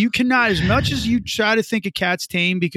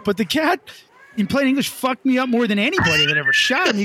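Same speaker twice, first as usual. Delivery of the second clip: ordinary delivery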